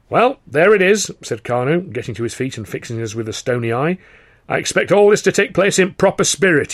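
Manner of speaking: fix them with a stony eye; stern boss. Acted. Carno stands, stern.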